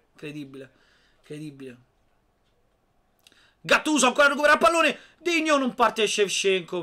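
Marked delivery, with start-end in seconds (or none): 3.6-6.8 breathless italian goal commentary. Real, not acted. Fast call of the play.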